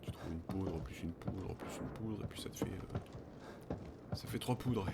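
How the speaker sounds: normal talking voice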